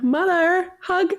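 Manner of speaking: put-on high, pleading little-kid call; toddler voice. Mother mimics son calling at three a.m., high childlike plea. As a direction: call out like a small child at night. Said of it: "Three in the morning".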